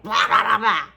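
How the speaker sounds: loud, mouthing-off blahs; mock outburst. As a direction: blurt out blahs, imitating someone having a moment. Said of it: "have a moment and be like".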